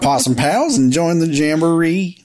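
high, loud hammy park host; theme park host voice. Retold or imitated. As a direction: welcome the crowd like a possum mascot host. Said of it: "Lester's Possum Park".

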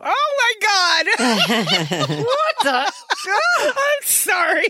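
high-pitched giggling fit, words squeaked out through laughs; helpless laughter. Real, not acted. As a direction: lose it completely, laughing and gasping through every word. Hosts crack up reading.

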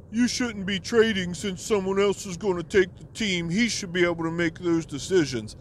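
high nasal-free whiny mimic; imitating a whiny middle-aged man; mocking a whiny guy. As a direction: mimic the league whiner, whining. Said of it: "this guy is so fucking whiny".

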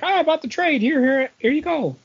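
high, eager mock voice of Paul; imitating Paul. Paul offering his copy, high mocking imitation. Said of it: "Paul was like".

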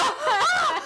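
high, loud, laughing shriek of embarrassment; embarrassed shriek. Retold or imitated. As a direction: shriek in mortified horror. Hearing own drunk voicemail. (hög, skrattande skamskrik)

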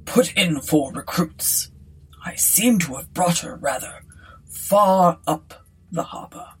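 leisurely, well-mannered captain; gentleman's voice. A narrator's reading. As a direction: speak with a gentleman captain's easy composure. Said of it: "he said in a gentleman's voice".

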